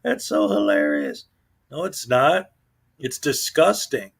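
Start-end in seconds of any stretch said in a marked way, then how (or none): 0.0-1.3 mock fan gushing, much higher, ending in a breathy hush. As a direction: ham up a starstruck fan. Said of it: "And his fans think".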